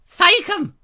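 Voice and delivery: high, loud screech of a Monty Python housewife; screeching woman impression. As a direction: screech her name across the room. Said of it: "stepped out of a Monty Python film".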